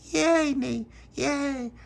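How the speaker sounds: put-on very high baby cheer. A narrator's reading. Baby Lucia cheers for her jam butty, very high.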